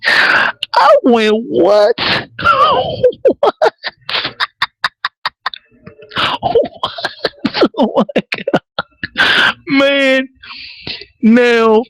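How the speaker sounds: real high, helpless laughter breaking up the words; laughing hard. Real, not acted. Reviewer cracking up at the killer's cleanup line, laughing hard. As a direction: squeal with laughter, barely getting words out. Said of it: "here's why I'm laughing so goddamn hard".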